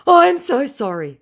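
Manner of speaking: high, loud whiny scammer voice; pleading scammer. Retold or imitated. Scammer confessing to her in a high whiny plea. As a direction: beg like a caught con man.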